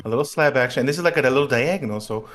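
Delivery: normal talking voice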